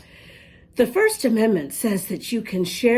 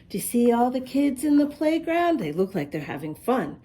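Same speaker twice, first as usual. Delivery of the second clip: ordinary delivery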